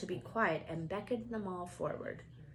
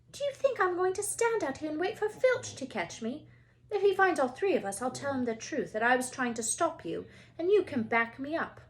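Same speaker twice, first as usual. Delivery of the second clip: higher, quick bossy argument; indignant Hermione; 6 semitones higher, 4 dB louder than usual. Acted. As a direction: lay down the plan bossily. Hermione argues her case.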